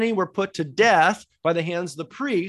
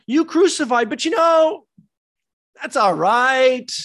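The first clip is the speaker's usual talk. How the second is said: higher, slowed, mock-gentle excuse; mock soft-pedalling Peter; 6 semitones higher, 6 dB louder than usual. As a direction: parody a timid bishop letting them off. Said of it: "Imagine Peter saying".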